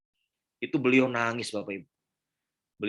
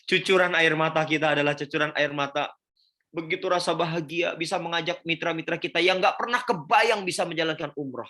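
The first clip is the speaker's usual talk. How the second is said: louder, higher, voice swelling with feeling; fervent emotional swell; 6 semitones higher, 5 dB louder than usual. Real, not acted. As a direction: pour out the joy of it. Speaker moved, praising umroh joy. (luapan haru penuh semangat)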